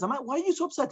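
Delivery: high, worried voice of a student; concerned question. Acted. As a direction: pitch it up as if anxious for his teacher. Ravina asks troubled Rav Ashi.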